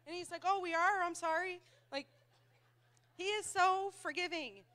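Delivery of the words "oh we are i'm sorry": high, innocent surprise into apology; gracious husband. Retold or imitated. Husband to his mad wife after bickering, innocently apologetic. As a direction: play the oblivious, easygoing spouse. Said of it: "My husband, he's so gracious".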